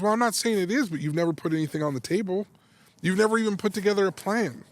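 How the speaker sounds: high, emphatic imitated wife; retold rebuke. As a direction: act out a spouse pushing back.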